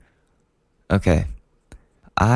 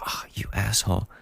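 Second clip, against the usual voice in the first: whispered; 6 dB quieter than usual. Retold or imitated. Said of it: "they're gonna be like".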